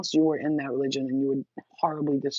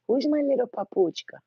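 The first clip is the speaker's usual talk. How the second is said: cooing pet talk; 8 semitones higher than usual. Real, not acted. Cooing pet names to dog.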